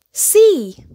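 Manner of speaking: high, loud joyful word; triumphant reveal. A narrator's reading. Narrator revealing they reached the sea, high and loud. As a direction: land the word like a big surprise. Climax of the journey.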